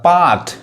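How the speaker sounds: पार्ट as "paat", no r; long open vowel in पार्ट, r dropped; silent r. Shown as a demonstration. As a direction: say पार्ट the British way, without r. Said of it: "आर नहीं पढ़ाता है".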